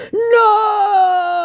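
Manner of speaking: high, loud wail of No!; mock scammer cry. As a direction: cry out like a wounded con man. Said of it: "he continues".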